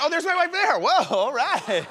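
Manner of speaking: high, delighted exclamation; spotting wife on screen, surprised high exclamation. Real, not acted. Host sees his wife's photo, delighted.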